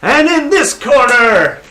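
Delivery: high, slow booming announcer call. Acted. Ring announcer introducing the next fighter, booming and slow. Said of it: "the announcer, uh, says".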